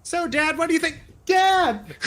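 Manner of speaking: high-pitched call, ending in a loud cry; child calling Dad. Pretend. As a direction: put on a high kid's voice, then yell.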